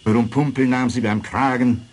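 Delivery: usual speaking voice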